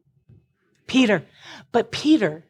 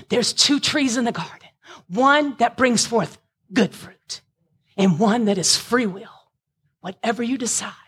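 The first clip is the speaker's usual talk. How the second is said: ordinary talk, nothing marked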